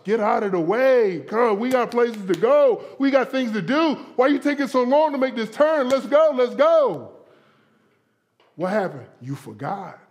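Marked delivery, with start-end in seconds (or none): 0.0-7.1 hammed-up road impatience, pitch climbing, getting louder; mock exasperated yelling, urging them on; impatient driver. Acts out impatient driver at newcomer.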